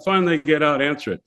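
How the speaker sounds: ordinary talk, nothing marked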